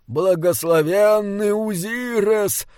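high, drawn-out invocation; muttered prayer. A narrator's reading. Old bishop prays wandering off into the garden, high intoned prayer. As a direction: intone it slowly like an old cleric praying. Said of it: "бормотал он".

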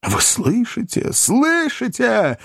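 high, slow, drawn-out appeal; outraged appeal. Acted. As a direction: call everyone to witness, stretching it high. Ben Allen appeals to those present.

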